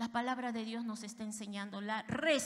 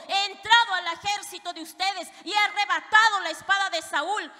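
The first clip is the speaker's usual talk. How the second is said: louder, higher taunting reproach; scolding challenge; 4 semitones higher, 7 dB louder than usual. Acted. David reproaching the general for sleeping, loud scolding. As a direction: rub his failure in his face. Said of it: "David le dice".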